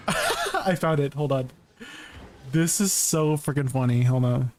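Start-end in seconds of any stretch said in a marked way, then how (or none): 0.0-1.1 laughing. Real, not acted. Streamer finds the meme to show chat, laughing. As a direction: laugh bursting out before the words.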